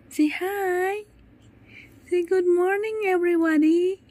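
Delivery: very high-pitched coaxing. Real, not acted. Prompting baby to greet viewers. (mataas at malambing na panghihikayat sa sanggol)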